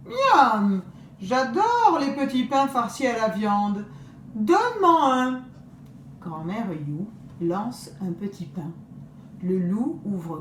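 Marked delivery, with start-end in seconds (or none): 0.1-5.4 greedy wolf, higher and louder. Acted. Wolf demanding a meat bun from grandmother, greedy. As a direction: smack the lips, hungry wolf.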